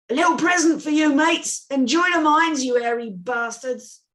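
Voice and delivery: cocky soldier jeering at the enemy; gleeful taunt. Acted. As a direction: toss the grenade with a mocking sneer. Villain taunts from the wall.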